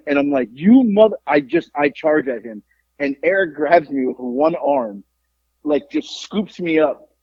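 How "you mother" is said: louder, higher cut-off curse; drunk angry outburst. Retold or imitated. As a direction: snarl it while lunging forward. Charging at him.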